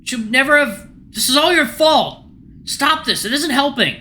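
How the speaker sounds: high, loud frantic yelling; panicked blaming shouts; screaming argument. Acted. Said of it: "screaming at each other".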